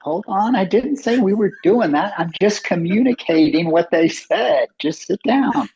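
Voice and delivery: high, urgent hold on; pleading calm-down. Retold or imitated. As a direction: talk him back into his seat. Calming the upset cofounder.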